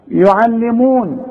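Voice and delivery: يعلمون recited slowly with every letter light. Shown as a demonstration. Teacher models يعلمون with all letters thin. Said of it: "كلها مرققة".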